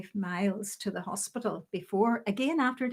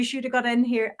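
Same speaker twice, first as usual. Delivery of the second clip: raised-voice scolding; guldering telling-off; 3 semitones higher, 6 dB louder than usual. Retold or imitated. Ward sister telling off late nurse, loud and cross. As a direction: drive the reprimand home loudly. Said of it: "It was a gulder down the ward".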